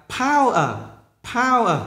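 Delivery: "power" high on the first syllable, then falling; nhấn âm đầu. Shown as a demonstration. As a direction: punch the start of "power". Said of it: "Tiếp tục là âm đầu". ("power" nhấn cao âm đầu)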